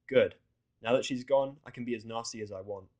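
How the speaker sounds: ordinary delivery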